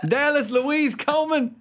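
high, slow mock Southern holler; Southern mother calling her kid by full name; Southern mom. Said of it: "I can picture a Southern mom".

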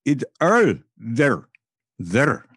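slow, deliberate imitation of a foreign caller; mock caller's voice. Caller repeating himself to the host on the phone, lightly voiced slow foreign accent. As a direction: spell it out slowly for a confused listener. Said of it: "He said".

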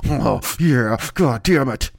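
hammed-up monster grunt of pleasure; snorting monster. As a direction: snort the words like a beast. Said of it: "snorting like the monster in The Prophecy".

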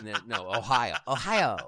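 "Ohio" repeated with a firmer push; mock insistence. Dad correcting a bank teller, mock-insistent.

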